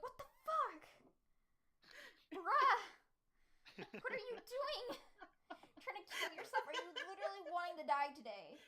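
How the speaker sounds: high, loud mock freakout. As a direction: yell at a reckless friend, half-laughing. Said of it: "I would be like".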